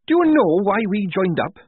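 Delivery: high, loud angry challenge; heated. A narrator's reading. Tonka red in the face, challenging heatedly. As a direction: demand it, worked up and loud. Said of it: "said Tonka, red in the face".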